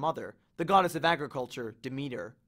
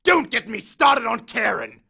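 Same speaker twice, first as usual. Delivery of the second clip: much louder, higher exasperated yell; 7 semitones higher, 8 dB louder than usual. Acted. Hades yells at Percy about Charon, exasperated yell. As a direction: blow up at the name. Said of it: "Hades yelled".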